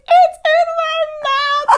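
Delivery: loud, high British shriek, hammed up through laughter; drawn-out, high-pitched mock British yell; British accent. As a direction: imitate a British woman shrieking in shocked hilarity. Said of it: "she's British".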